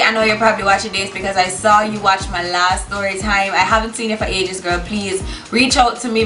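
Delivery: normal talking voice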